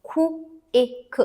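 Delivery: "खु, एक" as separate phonics sounds; each sound of "खु, एक" alone, with gaps. Shown as a demonstration. Teacher sounds out quick for learners, sound by sound. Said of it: "तो हम इसे break नहीं करेंगे".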